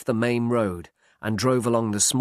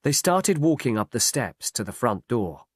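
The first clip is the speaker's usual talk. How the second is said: ordinary delivery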